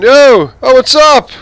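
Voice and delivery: high, loud, hyped-up "yo, what's up" street greeting; loud American guy greeting. Retold or imitated. A guy on the street greeting a stranger, loud and brash. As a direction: holler it like a brash New Yorker passing by. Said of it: "some guy walking down the road and be going".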